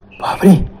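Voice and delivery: breathless whispered gasp of fear; frightened whisper. Retold or imitated. Hiker seeing the laughing men vanish, frightened whisper. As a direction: whisper it in shock.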